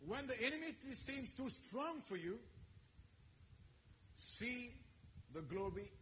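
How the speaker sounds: high, loud, slow preacher's cry; full-force sermon call; fiery preaching. Acted. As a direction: preach it out to the congregation.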